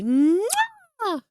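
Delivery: loud high smacking kiss; kiss sound. Acted. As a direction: blow a big kiss.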